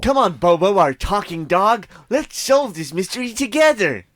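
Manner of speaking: rally the dog like a cartoon sidekick; bright cartoon hero call. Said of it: "Saturday morning cartoon".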